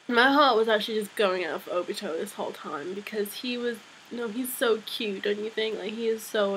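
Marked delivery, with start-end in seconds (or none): none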